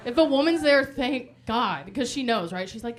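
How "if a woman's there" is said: normal talking voice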